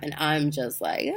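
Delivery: normal talking voice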